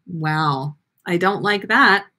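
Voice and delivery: ordinary delivery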